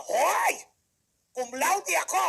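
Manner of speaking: loud, high, offended cry of Hoy; huffy graduate defending his honours; indignant protest. Acted. Fresh grad shouts back at the interviewer: loud, high, offended.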